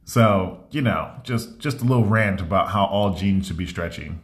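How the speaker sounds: ordinary talk, nothing marked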